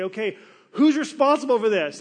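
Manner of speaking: loud, urgent demand from frightened sailors; panicked sailors. Retold or imitated. Sailors in the storm demanding who is to blame, loud and urgent. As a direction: shout it like desperate sailors in a storm.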